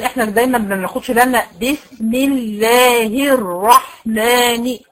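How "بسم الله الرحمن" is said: الرحمن drawn out slowly with each kasra clear; teacher demonstrates full vowels in الرحمن, slowly; slow recitation. Shown as a demonstration. تلاوة بطيئة. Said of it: "كلها مكسورة".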